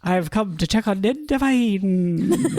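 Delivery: put-on slow, high Irish accent, laughing; a giggly, slow attempt at an Irish accent. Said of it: "Irish accent".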